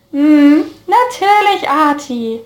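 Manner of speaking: high, sing-song teasing. A narrator's reading. Friend teasing Arthur with a high mocking lilt. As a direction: needle him with the nickname.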